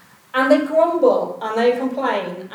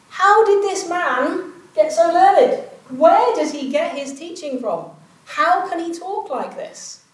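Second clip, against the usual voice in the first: higher, slow, incredulous questions; baffled leaders; 7 semitones higher, slower than usual. Retold or imitated. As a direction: leaders stunned by Jesus' learning, asking higher and slower.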